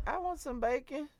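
put-on high, soft, slow child voice; little girl asking. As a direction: lightly imitate a small girl's request. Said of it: "She said".

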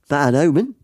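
mock Australian with a rising end; matey Australian twang, lifted ending; Australian accent. Tall man warns fellow passenger of bad luck, Australian accent. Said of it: "it's gone so Australian".

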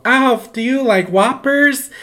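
put-on high, slow, loud child voice; imitated childish excitement; excited little kid. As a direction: ask a toy in gleeful sing-out. Acts self as child asking doll.

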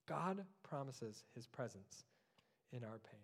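hushed repetition. Acted. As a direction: say it again, softly, to let it sink in. Repeats promise softer.

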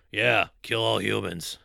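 slow, low henchman voice; Bane impression. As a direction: drag it out low and slow like a brute. Imitating Bane's one-note dialogue.

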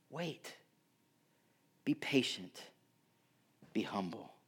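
soft, hushed and unhurried; gentle voice of God. Retold or imitated. God gently urging Habakkuk to wait, hushed and tender. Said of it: "God h-, tells Habakkuk".

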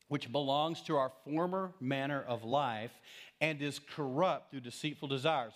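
usual speaking voice

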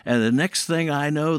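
normal talking voice

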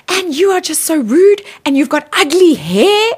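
hammed-up insult from an angry woman; retelling a stranger yelling in a car park; mock screaming woman. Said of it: "she starts screaming at me".

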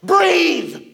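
put-on high, loud, exasperated shout at the child; a frazzled parent yelling at the kid, and the irony lands. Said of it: "I've so done this".

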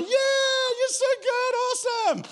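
very high, loud mock cheer, slow and drawn out; crowd cheering. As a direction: cheer wildly like an adoring crowd. Everyone cheering after the trumpets.

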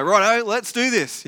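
pumped up like contestants; keen acceptance. Retold or imitated. Prophets eagerly accepting Elijah's contest, pumped and loud.

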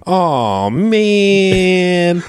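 high whining cartoon sigh; whiny cartoon fox. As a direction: whine it like a defeated cartoon villain. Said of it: "nasally, like, weasel voice".

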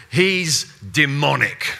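hostile accuser. Retold or imitated. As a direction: spit it out slowly as an accusation. Opponents accusing Jesus.

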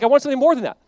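retold frustration. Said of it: "What, what frustrated her?".

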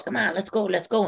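high-pitched rallying cry; imitated coach urging. Coach urging wrestler into another takedown, high imitated rallying.

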